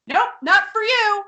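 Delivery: a hint of a movie-star tease; Richard Gere. [name] pulls the gift away from Joe, lightly imitating Richard Gere. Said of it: "basically does a Richard Gere in Pretty Woman".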